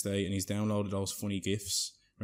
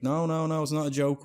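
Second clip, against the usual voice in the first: loud, higher-pitched protest; acted insistence; 6 semitones higher, 5 dB louder than usual. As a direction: insist over someone's doubts. Acted lads insisting gift is real.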